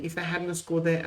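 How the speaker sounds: ordinary delivery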